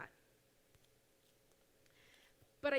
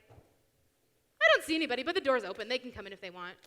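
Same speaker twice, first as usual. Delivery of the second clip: mock homeowner; 10 dB louder than usual. Mocking a passive homeowner.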